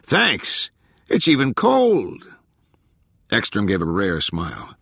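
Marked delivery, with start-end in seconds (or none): 0.0-2.3 high, drawn-out holler of thanks; shouted thanks from out in the crowd; crew member's yell. A narrator's reading. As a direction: yell back to the boss, voice pitched up. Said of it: "someone yelled".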